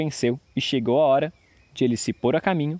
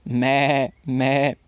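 drawn-out goat bleat. A narrator's reading. Goat answering the young man, bleating.

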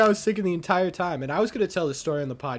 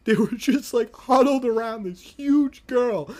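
ordinary talk, nothing marked

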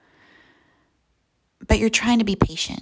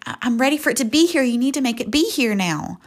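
put-on higher-pitched, hurried childlike insistence; impatient demand; 5 semitones higher, faster than usual. Squirming like a two-year-old, pleading with Jesus, impatient demand. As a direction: demand it right now, rushed and raised.

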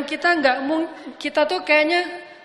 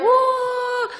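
much higher, loud yell of joy; joyful whoop; 12 semitones higher, 3 dB louder than usual. Retold or imitated. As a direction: let out a wild happy whoop. Shouting for joy in street.

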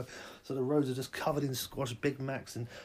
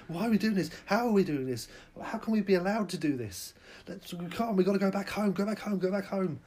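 high, loud panicky pleading; frantic, begging to turn back; panicked cry to go home; 6 semitones higher, 4 dB louder than usual. Acted. Drivers losing their minds, pleading to go home, panicked.

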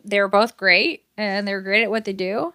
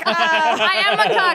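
mock Aquaman; 6 semitones higher, slower than usual. Mocking Aquaman's self-pity.

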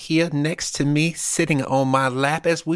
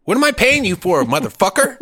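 loud, high exasperated demand; mock angry demand; 10 semitones higher, 4 dB louder than usual. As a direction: bark it like an annoyed boss.

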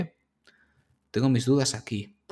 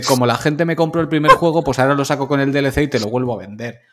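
greedy company voice; 4 semitones higher, 8 dB louder, faster than usual. Retold or imitated.

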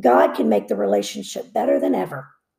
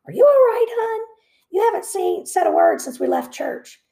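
much higher and louder, a caring wife; concerned wife; 13 semitones higher, 4 dB louder than usual. A narrator's reading. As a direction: voice the anxious wife asking gently. Wife asks worried husband.

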